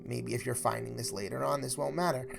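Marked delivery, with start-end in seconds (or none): none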